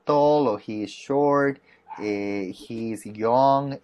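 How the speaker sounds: each short sentence stretched out slowly; slow model sentences. Shown as a demonstration. Teacher giving a student slow model sentences about his brother. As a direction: spell out each example, unhurried. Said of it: "cuando hablamos de brother".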